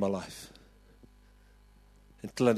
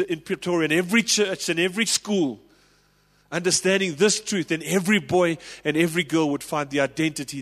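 louder and higher, driving hard; preaching at full force; 5 semitones higher, 4 dB louder than usual. Acted. Pastor rallying the church, full preaching voice. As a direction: preach it to the back row.

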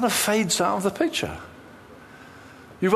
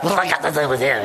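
mock harsh retort; much faster than usual. Shown as a demonstration. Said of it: "he would have said it like that".

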